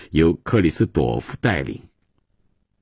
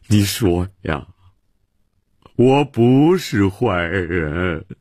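slow, drawn-out whining plea; a drunk father begging to be told he's good; mild self-pity, slowing down; slower than usual. Acted. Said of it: "曼西沃自怨自叹地说".

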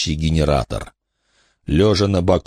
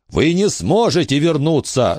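high firm shout; 9 semitones higher than usual. A narrator's reading. Billy shouting at the ghost that he can't return. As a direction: insist over the panic. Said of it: "закричал он".

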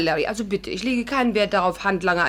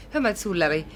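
normal talking voice

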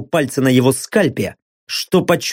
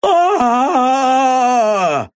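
high pained scream; agonized scream; 7 semitones higher than usual. Acted. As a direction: scream as the shard goes in. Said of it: "заорал, и на этот раз куда громче".

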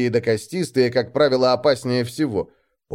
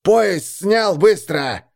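raised, forceful command; barked order; 7 semitones higher, 4 dB louder, much slower than usual. A narrator's reading. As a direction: order the captive sharply. Gang leader orders the captive.

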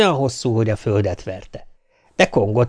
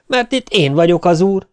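defiant shout; 6 semitones higher than usual. A narrator's reading. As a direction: stand your ground and yell it. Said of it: "kiáltotta a szegény ember".

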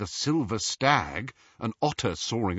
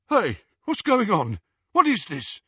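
confused; 9 semitones higher, 4 dB louder than usual. A narrator's reading. Balding wizard confused.

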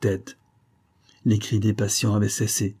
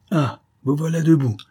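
raised, higher voice with a touch of fury; angry cry; 6 semitones higher, 4 dB louder than usual. A narrator's reading. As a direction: call out in anger at a captive. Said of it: "criait Escovedo". (cri de colère, voix plus haute)

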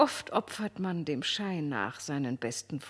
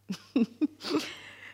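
light high laugh; amused laugh; 7 semitones higher than usual. Acted. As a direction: laugh at the ridiculous man. Marquise lacht über Châtelet. (helles, amüsiertes Lachen)

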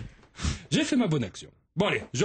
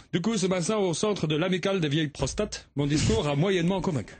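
usual speaking voice

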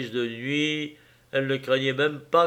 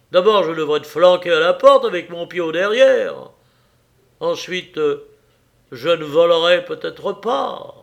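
much louder, higher shouting husband; enraged husband shouting at his wife; furious outburst; 5 semitones higher, 8 dB louder than usual. Acted. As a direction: blow up at her after slamming the table. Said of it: "donna un coup de poing sur la table".

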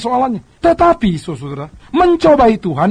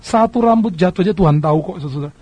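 ordinary delivery

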